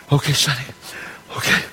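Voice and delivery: put-on nervous kid. The kid answers before throwing.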